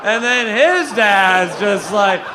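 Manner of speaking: "his" stretched long with a swooping rise; drawn-out stress on HIS. Real, not acted. Comic going back another generation of dads, "his" loudly stretched. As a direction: lean hard and loud on "his", dragging it out. Running bit, escalating lead-in.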